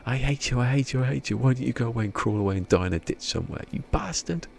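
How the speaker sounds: high, fast hammed-up tirade; mock angry rant. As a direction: rattle off the insults in a shrill mock rage. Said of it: "I love that on bottom".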